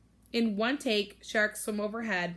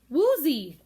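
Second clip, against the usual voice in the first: put-on toddler babble; 6 semitones higher, 8 dB louder than usual. Toddler babbling sister's name.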